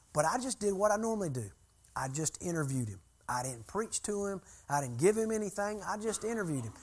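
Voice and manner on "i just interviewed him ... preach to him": ordinary delivery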